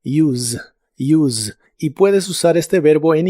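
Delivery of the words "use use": "Use" ending in a buzzing Z sound; "use" said as "yooz". Shown as a demonstration. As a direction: let the S in "Use" buzz like a Z. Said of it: "el sonido vibrante de la S".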